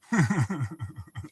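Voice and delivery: rolling chuckle; easy amused laugh; real chuckle. Real, not acted. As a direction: keep chuckling at the joke. Laughs after pool remark.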